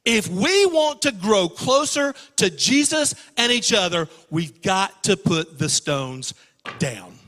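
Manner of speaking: rally the congregation to set the stones down; preaching appeal. Acted.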